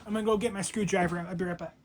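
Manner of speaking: ordinary delivery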